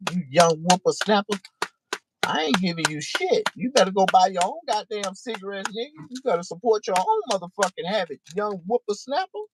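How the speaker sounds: put-on high, scolding old-timer voice; cranky old crackhead scolding. Old crackhead refusing Doobie a cigarette, scolding. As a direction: tell off the young punk like a grumpy old man.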